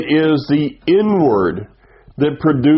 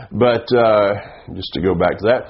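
ordinary delivery

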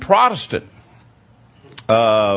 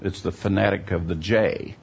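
ordinary talk, nothing marked

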